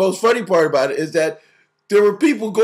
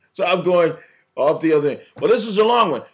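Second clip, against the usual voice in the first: ordinary delivery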